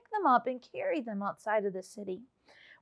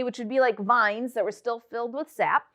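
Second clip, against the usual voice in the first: ordinary talk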